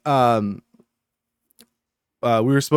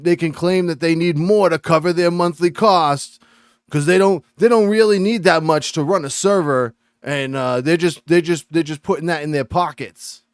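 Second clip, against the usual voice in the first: put-on higher, louder New York accent; 6 semitones higher, 4 dB louder than usual. Mocking forum complainers who say they did it on purpose, New York accent. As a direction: rant like a conspiracy-minded New Yorker. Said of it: "like, from New York".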